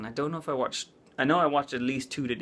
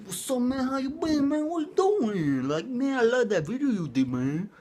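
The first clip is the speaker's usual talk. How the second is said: higher, laid-back dude voice; chill bro voice; 8 semitones higher than usual. Retold or imitated. Talking casually to guy YouTubers, imitating bro lingo. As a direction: play it like a chill guy greeting his bro. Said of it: "exactly like that".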